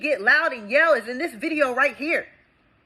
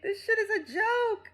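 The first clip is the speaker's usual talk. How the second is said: high, soft voice of disbelief; thin, exasperated high voice; exasperated squeak; 10 semitones higher, 8 dB quieter than usual. Real, not acted. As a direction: squeeze it out high and quiet. Exasperated after wailing about money.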